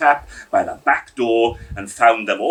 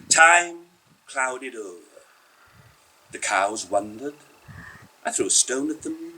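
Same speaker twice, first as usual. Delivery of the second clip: plain reading voice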